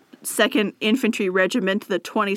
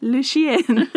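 high, silly fake French; mock French; 4 semitones higher, much slower than usual. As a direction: croon it like a cartoon Frenchman.

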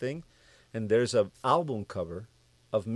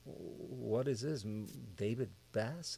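slow, soft, bewildered mutter; hushed and slow, as if reading something unbelievable; stunned disbelief; 12 dB quieter, slower than usual. Retold or imitated. The songwriter stares at the album cover, slow and baffled. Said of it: "I look at this cover and I go".

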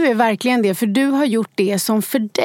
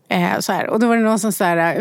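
ordinary talk

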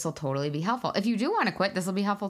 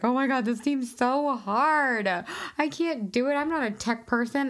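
high, slow, whiny put-on voice; hammed-up helpless self, pitched up high; a mock-panicked non-techie; mock whiny past self; 8 semitones higher than usual. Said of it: "and I was like".

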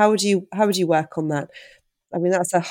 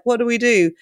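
mock panic; 6 semitones higher, 4 dB louder than usual. Wobble moment, asking for help.

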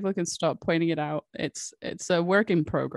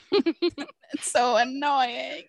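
slow, high, whiny moan; whiny drawn-out complaint; 6 semitones higher, much slower than usual. Real, not acted. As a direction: drag it out in exasperation. Complaining about controller buttons.

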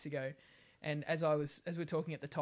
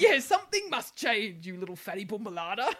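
mocking doctor; 5 semitones higher, 8 dB louder than usual. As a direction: ham up a patronising doctor. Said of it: "You've gotta give something up".

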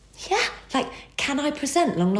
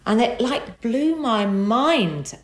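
ordinary talk, nothing marked

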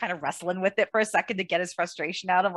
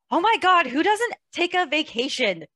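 high, loud incredulous gasp; mock disbelief; 10 semitones higher, 5 dB louder than usual. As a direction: act shocked anyone skips a vacation. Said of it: "And I was like".

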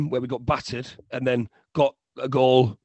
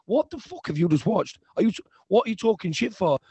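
higher-pitched disbelief, re-enacted; retold incredulous challenge; 7 semitones higher than usual. Speaker retells interrupting a fan's phone call, incredulous re-enactment. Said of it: "interrupted his phone call and went".

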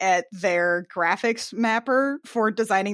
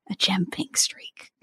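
whisper; 2 semitones lower than usual. Real, not acted. Whispered aside about hair.

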